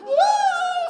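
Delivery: put-on high wailing child's cry, far above his voice; mock child's sob; crying little girl. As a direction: wail like a small girl in tears. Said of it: "starts up and crying".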